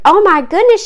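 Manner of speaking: high, loud exclamation; alarmed cry. A narrator's reading. Mother finds her hurt son, crying out. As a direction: cry out in shock. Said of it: "she cried".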